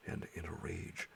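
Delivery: ordinary delivery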